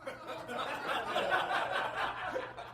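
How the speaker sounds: high, airless giggle; soft wheezing laugh; breathless laugh. Real, not acted. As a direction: laugh at the flop. Laughing at zinger fail.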